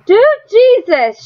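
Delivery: slow, high and loud panicked cry; high wild scream. A narrator's reading. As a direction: let it tear out high and wild. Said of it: "she screamed, her voice high and wild".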